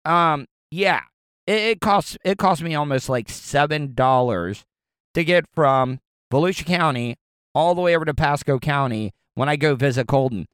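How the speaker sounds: normal talking voice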